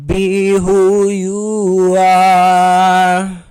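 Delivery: loud, very slow, drawn-out declaration; triumphant stretched-out cry; grand proclamation. Retold or imitated. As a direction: belt it like an anthem of self-acceptance. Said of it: "And I was like".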